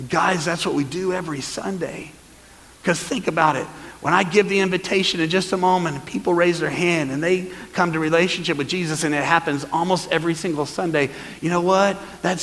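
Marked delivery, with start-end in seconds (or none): none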